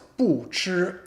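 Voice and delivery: 不吃 with 不 falling, then 吃 high and level; 不吃 as a falling-then-level tone demo; fourth tone, first tone. Shown as a demonstration. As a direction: drop on 不, hold 吃 high and even. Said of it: "fourth tone, first tone".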